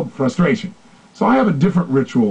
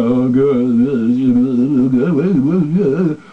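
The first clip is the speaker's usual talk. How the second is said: lower, heavy, resigned "good"; dropped depressed tonality; 3 semitones lower, much slower than usual. Retold or imitated. Coach mimicking a depressed client, voice dropped lower. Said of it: "drop my tonality down to".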